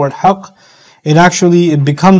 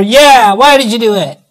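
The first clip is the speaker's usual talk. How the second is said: lightly voiced little kid, pitched up; little child chiming in; 5 semitones higher than usual. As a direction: imitate a kid echoing a scolding parent. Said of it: "one of my little ones comes over".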